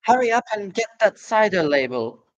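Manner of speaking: high calling woman's voice from upstairs. Acted. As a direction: call down from the house above. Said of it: "the voice called".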